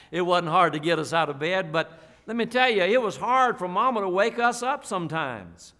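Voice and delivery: ordinary talk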